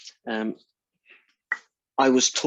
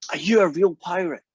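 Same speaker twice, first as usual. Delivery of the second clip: lightly voiced kid, higher pitch; little kid; 6 semitones higher, 4 dB louder than usual. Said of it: "he looks at me and says".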